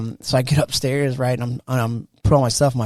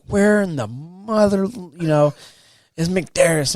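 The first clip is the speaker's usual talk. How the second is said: slow, high, mock-furious demand; irate drill sergeant; 5 semitones higher, slower than usual. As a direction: storm in and stretch out each word. Huge sergeant storms in.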